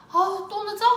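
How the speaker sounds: high, loud exasperated burst; exasperated complaint. Retold or imitated. Wife to husband calling that he'll be late, exasperated.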